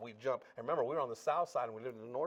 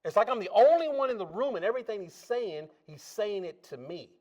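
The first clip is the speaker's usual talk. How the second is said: ordinary delivery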